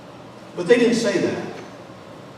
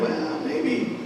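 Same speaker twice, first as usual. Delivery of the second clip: tentative musing; 9 semitones higher, 6 dB quieter than usual. Acted. Imagined disciples hesitating to answer.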